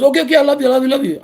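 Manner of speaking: high, loud, rushed okay okay I love you; hurried giving in. Retold or imitated. Girlfriend caving in to stop his pouting, high and hurried. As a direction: blurt it out to make him stop.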